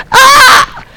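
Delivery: sudden high yelp of fear; mock scream of fright. Shown as a demonstration. Preacher acting out a fright, mock scream. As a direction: shriek like something scared you.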